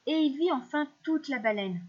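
usual speaking voice

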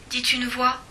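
usual speaking voice

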